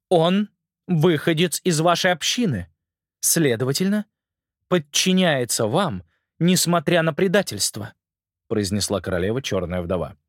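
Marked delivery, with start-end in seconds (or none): 0.1-7.9 slow, lightly voiced stern queen; a hint of a cold, commanding queen.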